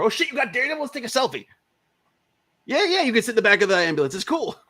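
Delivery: high, gushing fanboy voice; starstruck excitement. Retold or imitated. Ambulance crew meets costumed Daredevil, starstruck excitement.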